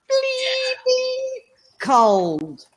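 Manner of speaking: high, drawn-out BLEEDING, then cold; exaggerated emphasis. Real, not acted. Australian guest bemoaning Midwest cold, hugely drawn-out BLEEDING. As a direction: stretch "bleeding" right out, very high. Comic complaint about Midwest weather.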